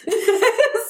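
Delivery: high, loud burst of laughter. Retold or imitated. Mom laughing at her wrong age, loud high laugh. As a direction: cackle at your own mistake.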